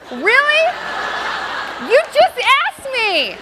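high, loud shriek of indignation; hammed-up exasperated screech, drawn out; exasperated shout. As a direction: yell it at the nurse in comic outrage. Retold outburst at the nurse.

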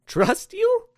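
high, loud incredulous question; scoffing disbelief. Acted. Man laughs off Santa's plea for trust, scoffing. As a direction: throw it back as a shrill-pitched scoff. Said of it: "Andrew laughed, guffawed even".

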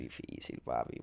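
breathy whisper of "Difícil, papi". Real, not acted. Guest remembering hard times in Miami, whispered.

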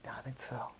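soft unvoiced whisper. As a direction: whisper it into his ear. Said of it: "He whispered in Ollie's ear".

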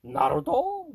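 Naruto? jumps high and loud in disbelief; Naruto? as if it's an absurd idea; Naruto? squeaked up high, incredulous; mock disbelief. Shown as a demonstration. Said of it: "echoing in mock disbelief".

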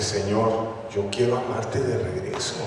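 very soft, low vow to God; tender prayer. Retold or imitated. Forgiven believer answers the Lord's love, tender and hushed. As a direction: pray it hushed and low, sincerely.

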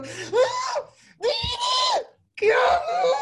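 very high mock shriek; desesperación exagerada. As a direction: squeal it in comic desperation. Imita su propio arranque de desesperación. (chillido desesperado y teatral)

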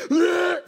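a loud, high mock retch; hammed-up gagging ugh; retching groan. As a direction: act out the heave before throwing up. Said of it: "He's like".

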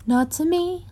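high, pressing insistence; insistent. Acted. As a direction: push the point hard. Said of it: "Cass insisted".

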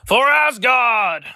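loud, slow heroic war cry; viking battle cry. Pretend. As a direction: bellow it like a charging viking. Viking battle cry throwing corn cob.

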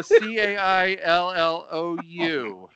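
spelling out. Real, not acted. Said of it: "put Caillou in Pornhub".

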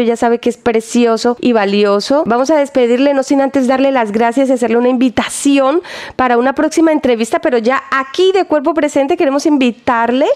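ordinary talk, nothing marked

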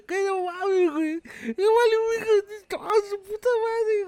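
high, loud frantic wail; shrill panicked sobbing coworker; panicked crying coworker. Retold or imitated. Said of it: "esta pendeja llorando al lado mío".

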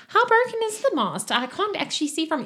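very high feminine voice; high, querying falsetto; boatwoman's voice. Acted. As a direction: put on a woman's high pitch. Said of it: "she's like".

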